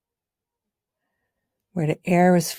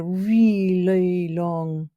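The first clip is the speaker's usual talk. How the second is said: "really long" stretched out slowly; drawn-out emphasis; 2 semitones higher, 3 dB louder, much slower than usual. Shown as a demonstration. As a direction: draw out "really long" to show the length. Stretches the words to illustrate length.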